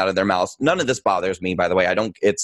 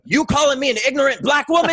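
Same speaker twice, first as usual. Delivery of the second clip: sharp, much higher, outraged challenge; indignant retort; 13 semitones higher than usual. Retold or imitated. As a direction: throw the question back at him, offended and rising. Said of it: "And she was like".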